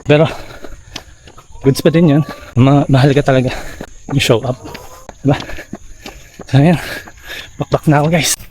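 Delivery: slightly out of breath mid-run; while running. Real, not acted. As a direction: talk between strides, breathing hard. Talking while running hard.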